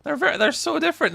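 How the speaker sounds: exasperated. Real, not acted.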